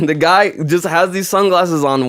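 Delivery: ordinary talk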